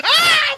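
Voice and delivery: high loud panicked yelp; short real fright scream; startled shriek. Real, not acted. Bird moves suddenly, man shrieks in panic.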